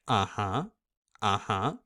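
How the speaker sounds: "Uh-huh" dropping then coming back up; falling-rising "Uh-huh"; dip and rise. Shown as a demonstration. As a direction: let "Uh-huh" fall, then lift it. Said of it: "starting, dropping, coming back up".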